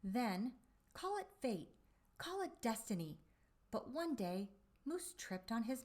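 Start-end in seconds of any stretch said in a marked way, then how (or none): none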